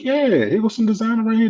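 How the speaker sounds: high-pitched, eager retold voice; imitated proud showing-off. Relative shows newcomer designer clothes, imitated proud showing-off. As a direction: present the find like a prize.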